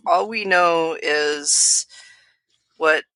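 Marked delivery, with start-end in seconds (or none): none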